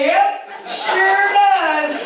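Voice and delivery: high, very slow, laughing through it; laughing admission. Retold or imitated. Admits it hurts, laughing.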